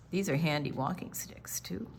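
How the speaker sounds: whispered aside. Real, not acted. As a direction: hush it like a secret.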